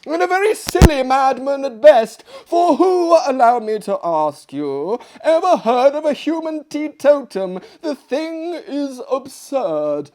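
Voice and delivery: high, loud, breathless madwoman ranting; excited lunatic guest. Acted. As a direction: rant gleefully like a lunatic at table. Dinner guest raves about madman.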